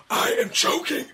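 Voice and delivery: high, voiceless attempt at yelling; strained yell. Host re-enacts pleading with passers-by, high strangled yell. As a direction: force out a choked mock cry for help. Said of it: "I yell out".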